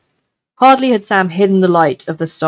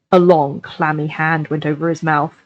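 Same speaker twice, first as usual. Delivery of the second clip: ordinary delivery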